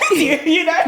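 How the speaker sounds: high giggling through the words; laughing. Real, not acted. Host teased by guest, laughing through words. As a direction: laugh it off, caught out.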